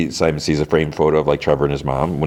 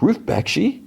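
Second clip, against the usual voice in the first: high, questioning repeat of the name; surprised echo; slower than usual. Retold or imitated. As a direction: echo it back in mild disbelief, pitched up.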